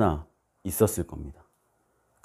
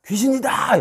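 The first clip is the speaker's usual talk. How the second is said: high-pitched shout of alarm; sudden acted yell at a ghost; acted panicked cry; 4 dB louder, slower than usual. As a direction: cry out like a frightened disciple. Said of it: "제자들의 반응은".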